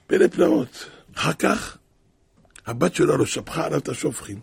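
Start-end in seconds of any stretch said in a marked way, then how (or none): 0.1-0.9 marveling. A narrator's reading. Marveling at the miracle.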